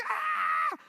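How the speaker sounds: put-on high squealing kids' yell; kids' excited scream. Class of kids shrieking in excitement.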